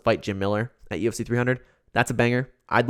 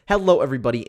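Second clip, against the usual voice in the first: ordinary talk, nothing marked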